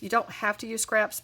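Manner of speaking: ordinary talk, nothing marked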